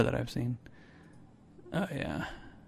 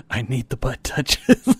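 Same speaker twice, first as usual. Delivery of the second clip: laughing. Acted. Laughing joke line.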